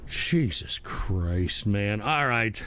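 very low, slow, soft sigh of words; weary exasperation. Real, not acted. Host who has had enough of trolls, weary exasperation. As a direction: let it sag out, fed up.

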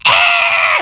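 high gasp into an "ah"; high-pitched startled cry. Real, not acted. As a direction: gasp sharply, pitch leaping up. Reacts to something turning blue.

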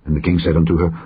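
plain reading voice

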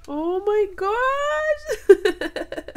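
high, loud, drawn-out laughing cry; laughing delight. Real, not acted. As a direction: laugh it out, stretched and overjoyed. Overwhelmed by the gift.

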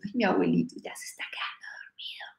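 put-on hushed, fast whisper of a scheming kid; sneaky whispered aside. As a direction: whisper it like a naughty grandson plotting. (susurro travieso de niño)

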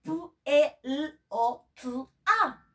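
"P L O T A" slowly, letter by letter; very slow spelling; spelled very slowly. Shown as a demonstration. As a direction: drag the letters out. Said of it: "Vayan a buscar una".

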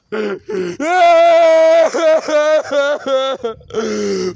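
long, loud crying wail. Real, not acted. Overwhelmed by schoolwork, loud crying wail. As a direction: let out a real anguished wail. Breaking down over work.

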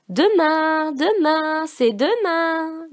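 high, eager chant of demain; a child calling out the word again and again; high child's voice crying out; excited child calling. A narrator's reading. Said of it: "criait Marie-Parlotte".

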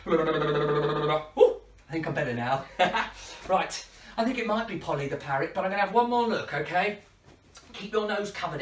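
normal talking voice

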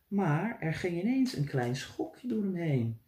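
ordinary delivery, nothing marked

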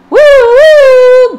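very high, loud, sung siren woo; siren wail. Acted. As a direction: wail like an ambulance. Siren sound again.

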